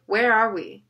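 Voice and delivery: loud firm demand. A narrator's reading. Jonah confronting the captive adults, loud demand. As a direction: insist on an answer. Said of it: "Jonah demanded".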